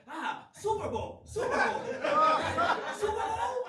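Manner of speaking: excited high calls of Super Bowl, laughing; imitating Japanese fans. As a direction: mimic eager locals shouting Super Bowl. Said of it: "They would just come up to me and be like".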